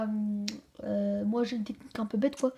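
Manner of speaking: usual speaking voice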